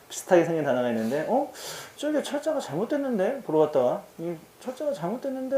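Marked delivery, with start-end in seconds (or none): 1.3-3.4 high, soft questioning thought; puzzled aside. Retold or imitated. Teacher recalling spotting a misspelt cigarette name, puzzled high aside. 4.2-5.6 high, soft questioning thought; puzzled aside. Retold or imitated. Teacher recalling spotting a misspelt cigarette name, puzzled high aside.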